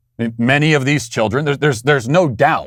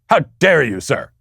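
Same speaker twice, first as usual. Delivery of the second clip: mock outrage; 4 semitones higher than usual. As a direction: play the offended aristocrat, over the top. Mocking a critic's outrage.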